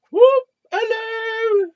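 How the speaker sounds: very high, loud falsetto whoop and hello; silly high greeting. Pretend. Messing about with his Ganesh avatar, silly falsetto greeting. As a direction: pop up squealing a playful hello.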